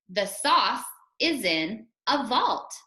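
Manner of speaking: slow, phrase by phrase; measured reading with gaps between chunks; teacher's model reading. Shown as a demonstration. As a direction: model it slowly for children to copy. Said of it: "Listen to me first".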